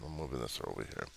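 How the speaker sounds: ordinary talk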